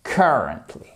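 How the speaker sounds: "currently" with the first syllable stressed, then falling. Shown as a demonstration. Teacher showing learners where the tonic accent falls, first-syllable stress. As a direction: lean on the start of "currently". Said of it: "vous voyez qu'on dit".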